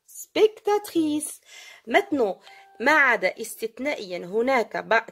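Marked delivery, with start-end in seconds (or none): 0.1-1.4 very slow and higher, syllables stretched; deliberate model word. Shown as a demonstration. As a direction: model it slowly for the class, pitch raised. Teacher contrasts feminine with masculine. (mot modèle très lent, voix plus aiguë)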